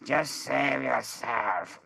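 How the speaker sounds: a hint of a nervous co-star, drawn out; imitating Kieran. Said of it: "And he goes".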